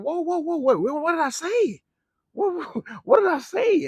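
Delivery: very high, squeaky comic alarm; hammed-up falsetto bewilderment; retold panicked confusion. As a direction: repeat it in rising mock panic. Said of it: "I was like".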